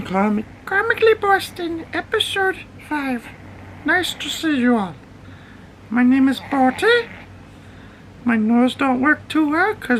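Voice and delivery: very high squeaky dog voice introducing the show; dog's voice. Pretend. Owner voices his pug Bertie hosting the show, very high squeaky voice. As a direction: speak as the pug, high and pleased.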